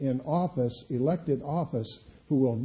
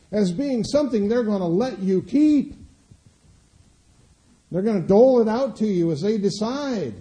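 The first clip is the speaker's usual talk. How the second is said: indignant preaching; 8 semitones higher, 7 dB louder than usual. Acted. Preacher to congregation about Social Security, indignant and much higher. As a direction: rail against them taking your money.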